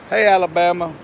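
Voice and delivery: ordinary delivery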